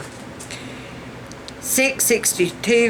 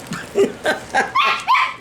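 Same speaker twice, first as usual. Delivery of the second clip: real burst of laughter; high, voiceless laugh. Real, not acted. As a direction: crack up at your own joke. Laughs at her own quote.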